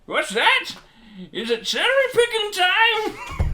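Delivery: put-on high, slow crazy old coot; squeaky old prospector voice. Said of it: "I made him like an old prospector".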